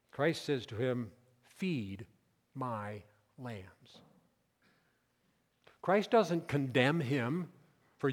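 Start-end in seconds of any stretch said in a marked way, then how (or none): 1.4-4.0 soft, low, with pauses between words; gentle commission. Acted. Christ commissioning a humbled Peter, gentle. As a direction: entrust it quietly, word by word.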